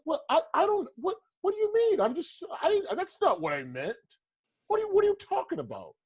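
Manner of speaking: high-pitched, innocent mock whine. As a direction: play the clueless guy caught out, squeaky and high.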